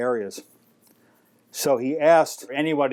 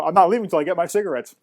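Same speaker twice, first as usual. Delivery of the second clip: fast, higher, louder comeback; retold defiance; 5 semitones higher, 4 dB louder, faster than usual. Narrator refusing the owner's order to leave, mock defiant. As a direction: fire back stubbornly, acting out the row.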